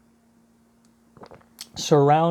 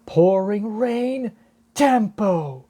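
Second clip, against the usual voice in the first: higher, slow attack-name call; 6 semitones higher, slower than usual. Acted. Nami casting rain on the fires, calling out the attack. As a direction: announce the move, drawing out the words.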